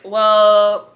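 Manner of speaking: bursting into giggles. Real, not acted. Laughs at own ending.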